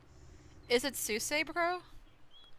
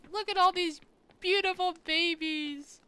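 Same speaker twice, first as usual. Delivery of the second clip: higher, louder squeal of delight; gushing delight; 8 semitones higher, 5 dB louder than usual. Real, not acted. Streamer finds the babies in the game again, squealing happily. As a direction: gush over the babies like a proud aunt.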